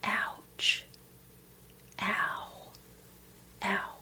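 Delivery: very soft, whispered ouch; breathy, slow and spaced-out pain; mourning in pain. Acted. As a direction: let out small hushed cries of hurt. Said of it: "The one that will work is to go".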